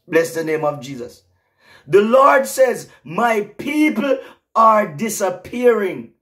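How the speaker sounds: high, loud prophetic preaching, slowing on the key words; fervent pulpit proclamation; preaching at full force. Acted. Preaching continues.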